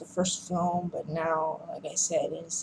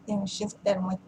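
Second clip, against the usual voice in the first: usual speaking voice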